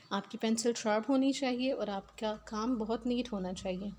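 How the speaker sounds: normal talking voice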